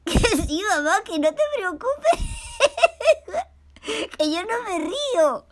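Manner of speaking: put-on a kid promising not to laugh while laughing; giggling. Paco responde riéndose. (Paco promete riéndose que no se ríe)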